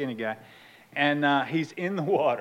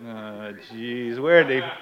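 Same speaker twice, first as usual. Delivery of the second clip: groan, then louder complaint; imitated exasperated kids; 12 dB louder than usual. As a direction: complain to dad in disbelief. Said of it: "got the same look on their face".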